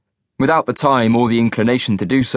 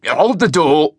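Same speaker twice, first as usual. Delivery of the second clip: shouted; 5 semitones higher, slower than usual. A narrator's reading. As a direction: shout to stop the lift in time. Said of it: "he shouted".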